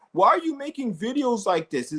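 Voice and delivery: high, mocking voice for the hater; lightly mocking whiny hillbilly. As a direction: mimic a whiny critic to ridicule him. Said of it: "He's all like".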